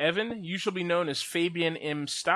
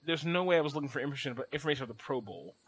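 fast muttered protest; 2 semitones lower, 5 dB quieter, much faster than usual. Retold or imitated. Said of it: "I'm like".

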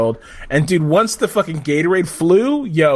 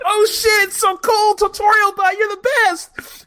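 high-pitched, mock-amazed fan gush; gushing crowd. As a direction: imitate an awestruck crowd, pitched way up. Acting out an impressed crowd.

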